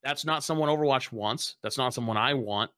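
normal talking voice

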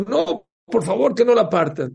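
high, loud begging; mock desperate plea. Mother-in-law begging the king to spare him, desperate. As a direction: beg urgently, voice leaping up.

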